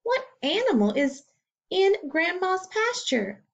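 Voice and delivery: plain reading voice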